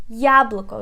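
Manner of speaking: "Яблоко" with the first syllable Я punched loud; stress on first syllable. Shown as a demonstration. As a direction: lean hard on Я in "Яблоко". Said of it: "We put stress on the first syllable".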